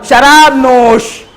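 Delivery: शराब नोश spelled out slowly and clearly; slow teacher model of शराब नोश, each word drawn out; drawn-out demo. Shown as a demonstration. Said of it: "शुरू में इसमें बढ़ाइए".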